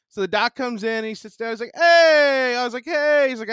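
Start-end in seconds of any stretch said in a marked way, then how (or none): 1.7-2.5 high, loud hey; bright greeting pitched high; doctor greeting the patient, mock cheery hey. Doctor's greeting voiced in story. 2.8-3.3 high, loud hey; bright greeting pitched high; doctor greeting the patient, mock cheery hey. Own greeting back, retold.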